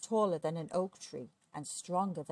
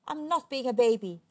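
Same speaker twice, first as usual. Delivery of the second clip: loud, higher-pitched denial; offended storybook giant; indignant protest; 5 semitones higher, 7 dB louder than usual. A narrator's reading. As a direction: protest sulkily like a child. Giant protests at wife's teasing.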